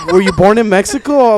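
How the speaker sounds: pry a little too hard; nosy questioning. Retold or imitated. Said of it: "they're like".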